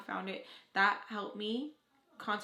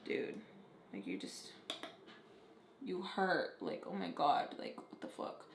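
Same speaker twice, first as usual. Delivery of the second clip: hushed; 3 semitones lower, 6 dB quieter, slower than usual. Retold or imitated. Voicing a hurt person.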